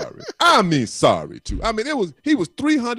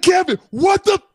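retold angry shout; slower than usual. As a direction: yell at the blocker who let him through. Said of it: "I said".

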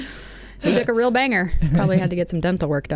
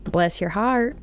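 put-on Southern accent; 3 semitones higher, much slower than usual. Teasing Southern phrase.

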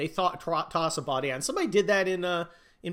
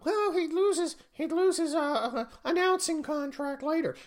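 higher mock whiny objection; lightly hammed-up higher whine; mock objector voice; 13 semitones higher, 3 dB louder than usual. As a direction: voice the naysayer, a bit higher. Mocking an imagined objector.

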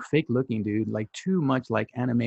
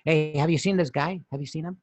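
light, casual asking after someone; ordinary guy voice. Acted. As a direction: ask it like a harmless regular guy. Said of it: "And you're like".

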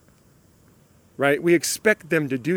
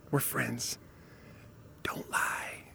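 confidential whisper, far softer; real quiet; 4 semitones lower, 17 dB quieter, much slower than usual. Shown as a demonstration. Speaker coaxing friends to admit their thoughts, real quietly. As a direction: drop to a playful whisper to coax a confession. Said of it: "I'm gonna talk real quietly with you".

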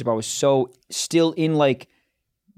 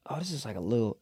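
low, soft, hesitant aside; quiet unease; 6 semitones lower, 12 dB quieter, faster than usual. Acted. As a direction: murmur it to yourself the morning after. Acted morning-after inner voice.

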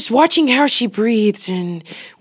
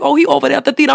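put-on louder, higher New Orleans girl accent; 6 semitones higher, 5 dB louder, much faster than usual. Showing the New Orleans accent she finally mastered. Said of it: "eventually I was like".

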